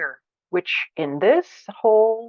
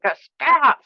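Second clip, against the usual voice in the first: a higher, firmer stop; angry stop; 7 semitones higher, 3 dB louder than usual. Shown as a demonstration. Teacher demonstrating anger: first, higher stop. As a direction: push back with the first stop. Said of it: "I'm even like".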